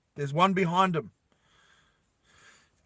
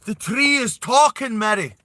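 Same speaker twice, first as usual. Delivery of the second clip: mum; 8 semitones higher, 7 dB louder than usual. Acted. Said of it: "the mum's like".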